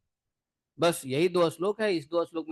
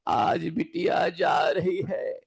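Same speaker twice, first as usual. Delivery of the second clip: wailing cry; slower than usual. Retold or imitated. Family sees daughter leave home.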